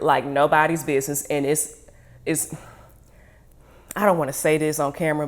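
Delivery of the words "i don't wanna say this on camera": ordinary talk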